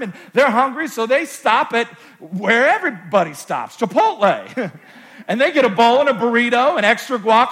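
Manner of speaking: loud, high, driving preacher delivery; rising sermon energy, pushing hard; full-force preaching. Acted. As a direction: preach it out to the back rows.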